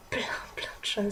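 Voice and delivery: soft whispered American-family gossip; whispered aside. Real, not acted. Student whispers to viewers about host family's gifts. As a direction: whisper it like a secret to the camera.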